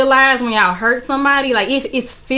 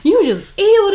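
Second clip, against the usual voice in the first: mock screaming; 8 semitones higher than usual. Confronting boyfriend on the phone about marriage, mock screaming. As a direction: relive the outburst high in pitch. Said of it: "I'm just screaming".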